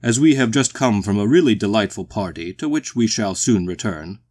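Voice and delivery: loud, tipsy formal boom; booming, genial walrus. A narrator's reading. The tipsy walrus tells strangers of the party, genial boom. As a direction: boom it out with hearty politeness.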